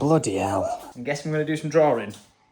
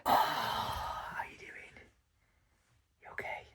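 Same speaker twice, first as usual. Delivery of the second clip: soft whisper; 10 dB quieter, slower than usual. Real, not acted. Dad greeting sleepy toddler softly.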